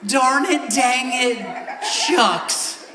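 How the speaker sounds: over-the-top prim cussing, building to a screech; shrill prim oaths. Retold or imitated. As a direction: squeal tiny harmless curses in mock politeness. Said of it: "and you".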